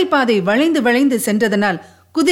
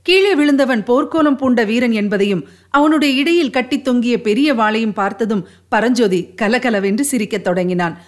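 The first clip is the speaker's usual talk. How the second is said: plain reading voice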